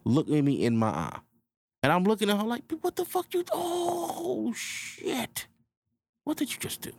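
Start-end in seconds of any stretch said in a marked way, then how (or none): 2.8-6.9 high cry fading into a stunned whisper; shocked disbelief. Acted. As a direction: gasp then whisper in total shock. Acted shock at girl's move.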